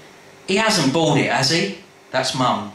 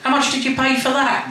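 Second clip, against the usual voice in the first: much higher, outraged mother; high indignant Mum; 12 semitones higher than usual. Acted. Mum demands to know what Lee paid, high and outraged. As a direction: pitch it up in disbelief. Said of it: "Mum wants to know".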